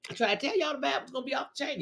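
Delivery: quick and hushed, tossing the line away; rushed repeat. Real, not acted. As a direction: rattle it off quickly under the breath. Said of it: "repeats the line".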